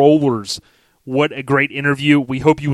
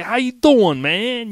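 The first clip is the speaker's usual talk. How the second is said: higher-pitched imitation of a Northeast accent, How you doing drawn out; 9 semitones higher, slower than usual. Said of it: "I like that little accent you, you guys have in the Northeast".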